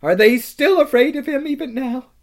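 high, loud and fast through tears; tearful disbelief. A narrator's reading. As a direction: plead in outrage. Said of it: "sobbed Susan".

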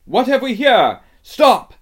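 high, loud witch's command; commanding shout. A narrator's reading. Witch spots a party and orders the sledge halted, commanding shout. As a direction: call a sharp halt, imperious.

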